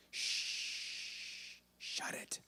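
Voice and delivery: a sharp whispered hush. Retold or imitated. Jesus silences the storm with a whispered hush. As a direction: hush the wind like shushing a child.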